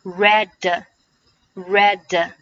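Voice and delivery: "read" slowly joined to a following "a", like "redda"; slow demo sliding "read" straight into "a"; "read" with a short uh linked onto the d; linked pronunciation. Shown as a demonstration. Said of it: "Read和a连读是".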